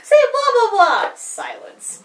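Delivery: put-on cheery kids' show host coaxing; Dora the Explorer. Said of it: "It's like Dora the Explorer".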